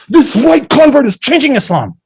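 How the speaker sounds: imitating an offended listener crying foul; mock outrage. Mimicking outraged congregants' reaction.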